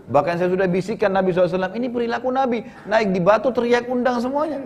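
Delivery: high-pitched, hurried mock protest; agitated self-defence. Jabir explains himself to his wife, agitated protest. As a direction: protest your innocence, pitch climbing high.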